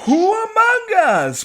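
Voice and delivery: very high, drawn-out echo; hammed-up plaintive declaiming, slow; theatrical mock cry. Co-host echoes the retort theatrically.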